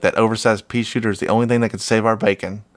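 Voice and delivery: ordinary delivery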